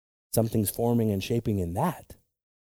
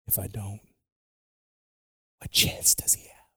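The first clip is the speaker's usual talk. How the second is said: quoting in a whisper. Retold or imitated. Quoting the father's words in a whisper. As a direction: breathe the quote as a whisper.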